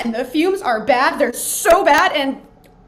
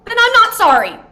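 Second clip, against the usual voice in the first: NOT hit hard, much higher and louder; defiant not sorry; 9 semitones higher, 11 dB louder than usual. Acted. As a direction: snap back, leaning on not. Defiant retort after apologizing.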